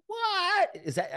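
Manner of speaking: high, slow, stunned question; amazed question. Retold or imitated. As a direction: stretch it out high in disbelief. Buddy amazed at his reading.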